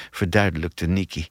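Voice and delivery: usual speaking voice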